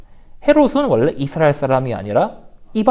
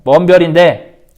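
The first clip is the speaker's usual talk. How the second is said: hammed-up king Herod; 7 dB louder, slower than usual. Herod questions the magi.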